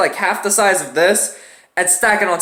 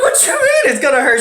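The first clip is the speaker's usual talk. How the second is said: mock panic; 7 semitones higher than usual. Scared patient quoting himself.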